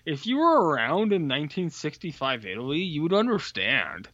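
imitating a condescending explainer, pitch lifted; know-it-all film buff. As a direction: correct them like a smug cinephile. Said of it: "there's people who's like".

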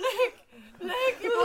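high, loud crying woman's call; man doing a woman's voice. Pretend. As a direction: wail for the dog in a high woman's voice. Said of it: "I'm trying to sound like the lady".

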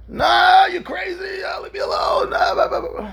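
high cried-out protest, trailing into babble; angry dismissal. Retold or imitated. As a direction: wail a refusal and wave him off. Patient angrily rejects the offer.

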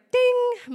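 high, ringing mock notification; phone chime imitation. IPhone goes off with email, imitated chime. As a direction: sing out a sudden alert tone. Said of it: "all of a sudden it's like".

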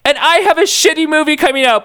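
high, deadpan-whiny aside; whiny add-on. Acted. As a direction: chime in pathetically.

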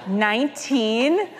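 drawn-out incredulous nineteen with a laugh; shocked kids. Retold or imitated. Kids in disbelief at her birth year, high and drawn out. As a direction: kids gasping at how old she is.

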